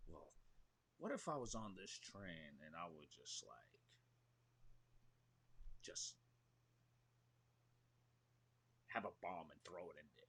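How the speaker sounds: 1 quiet menacing thought. Acted. Acting out crazy character thought.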